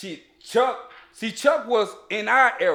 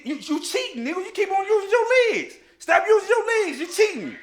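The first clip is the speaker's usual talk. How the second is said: put-on high, loud cheated-kid yell at the other boy; retold angry yell; 11 semitones higher, 5 dB louder than usual. Boy yelling at a fighter who keeps kicking, high and loud. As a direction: yell it like a kid who got kicked down again. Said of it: "I just yelled out".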